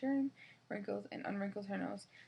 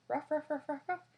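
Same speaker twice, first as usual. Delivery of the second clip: dog barking; 7 semitones higher, 3 dB louder than usual. Acted.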